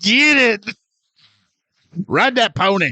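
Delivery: high-pitched pretend cheering on; lewd cheering. Co-host playing Gregor watching the couple, high cheering. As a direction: egg them on like a hooting spectator.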